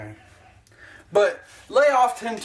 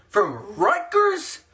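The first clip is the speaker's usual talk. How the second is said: incredulous; 8 semitones higher, much slower than usual. Real, not acted. Incredulous about coach's college.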